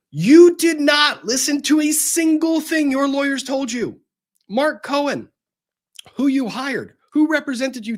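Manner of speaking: high, loud, slow and deliberate; exasperated shout. Acted. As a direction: hammer it home word by word. Ranting at SBF.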